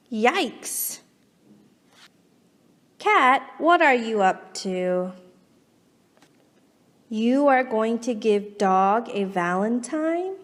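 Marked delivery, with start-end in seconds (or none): none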